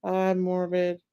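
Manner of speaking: usual speaking voice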